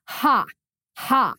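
single, separated scornful "ha"s; dry, deliberate fake laugh with a pause between; mock laugh. Shown as a demonstration. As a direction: laugh it off in two spoken bursts. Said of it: "I'm laughing here because my reaction is safety".

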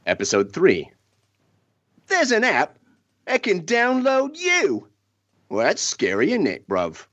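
put-on Cockney, cheeky at the tag question; Cockney accent. [name] reads the third parody plot line in a light mock Cockney. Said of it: "with your best accent".

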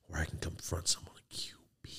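hushed dreamy whisper; imitating a whisper. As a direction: whisper it like a secret wish. Kid's wishful inner thought.

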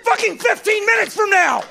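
put-on ranting about kids temp drinks; high outraged rant. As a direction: screech the indignation at the crowd. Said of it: "ranting outrage".

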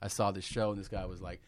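normal talking voice

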